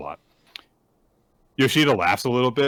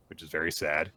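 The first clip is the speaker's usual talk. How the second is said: ordinary talk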